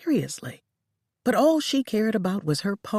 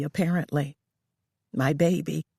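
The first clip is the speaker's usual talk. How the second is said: usual speaking voice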